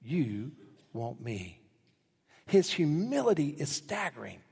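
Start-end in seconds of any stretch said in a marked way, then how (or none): 0.0-1.6 thrilled, humbled. Acted. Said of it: "And Boaz says".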